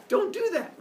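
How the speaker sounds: a hint of a fussy elder warning; scolding elder. Old person scolding kid.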